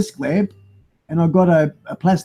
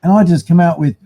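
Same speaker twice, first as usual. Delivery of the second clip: ordinary delivery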